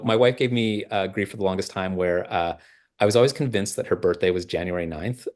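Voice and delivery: normal talking voice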